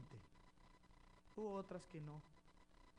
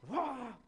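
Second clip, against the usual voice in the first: mock yell; 8 semitones higher, 10 dB louder than usual. Raising voice at wife.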